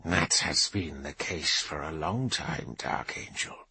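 low, slow, fading mutter. Acted. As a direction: mutter it under your breath. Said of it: "he muttered".

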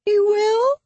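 put-on very high, small girl's voice; hopeful girl. A narrator's reading. Tearful girl asking her rescuer, high and hopeful. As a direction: ask in a tiny, high hopeful voice.

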